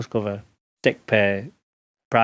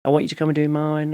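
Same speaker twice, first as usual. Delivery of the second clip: put-on London accent, pitched higher; imitated London accent; 5 semitones higher than usual. Retelling the client's phone call, imitated London accent. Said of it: "in his London accent".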